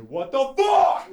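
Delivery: high, drawn-out mock scream; pissed-off mom. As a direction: shriek it as an outraged mother. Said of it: "and I just hear".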